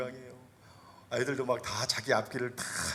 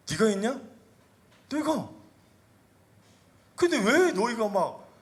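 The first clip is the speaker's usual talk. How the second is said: challenging question; 9 semitones higher, 6 dB louder than usual. Acted. Jesus questions proud disciples.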